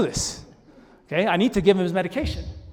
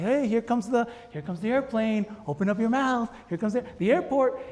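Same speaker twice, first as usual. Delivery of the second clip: higher-pitched parent playing airplane; playful airplane game; 5 semitones higher than usual. Dad feeding his five-year-old with the airplane game, playful. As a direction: sing out the airplane game to coax a child. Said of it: "I'm gonna do the little airplane game".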